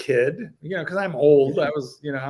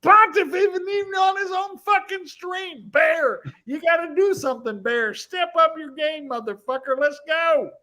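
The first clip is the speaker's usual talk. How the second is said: hammed-up mob boss, higher and louder, barking at the chat; a tough-guy hype man demanding super chats; mock mafia coach yelling at the stream; mobster pep talk; 7 semitones higher, 6 dB louder than usual.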